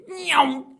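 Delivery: very high, loud zoom noise; whooshing meow. Retold or imitated. Bolt speeding past the interviewer, loud high whoosh. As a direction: zip past with a piercing meow. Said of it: "just fucking speeds past".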